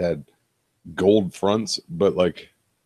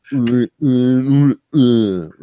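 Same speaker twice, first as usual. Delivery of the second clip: mock mumble, louder and pushed; louder mumbling; 4 semitones higher, 6 dB louder, much slower than usual. Mocking the gold-toothed guy, loud mock mumble. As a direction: grunt the uhs out louder. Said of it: "He just sort of like".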